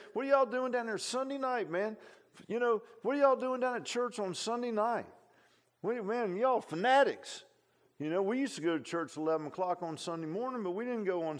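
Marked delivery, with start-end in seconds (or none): 0.1-7.4 high, mocking put-on voice; scoffing outsider. Preacher voicing an outsider mocking Sunday night church, high mock voice. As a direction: sneer at the church folks, pitched way up.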